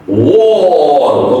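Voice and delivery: "wall" with rounded W and long aw; W onset. Shown as a demonstration. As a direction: round the lips and stretch "wall". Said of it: "and W".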